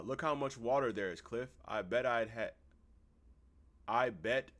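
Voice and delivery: ordinary talk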